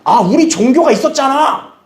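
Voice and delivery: high, drawn-out mock complaint; exasperated protest. Family objecting to a relative's new belief, exasperated protest.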